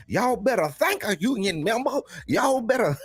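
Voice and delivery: fake Southern accent. Mocking the candidate's fake accent at a union rally. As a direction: imitate her fake down-home accent. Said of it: "The fake accent".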